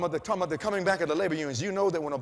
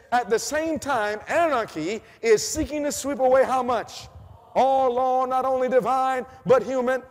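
loud, high, slow reading of prophecy; preaching; 7 semitones higher, 7 dB louder, slower than usual. Acted. Preacher reading prophecy aloud to the church, loud and slow. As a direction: proclaim each phrase to the congregation.

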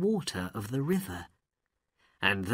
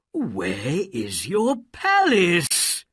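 loud, high, slow angry demand; angry cry; 7 semitones higher, 6 dB louder, slower than usual. A narrator's reading. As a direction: demand it furiously, drawing out the words. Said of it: "he cried angrily".

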